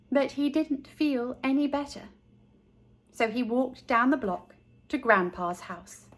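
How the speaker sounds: ordinary delivery, nothing marked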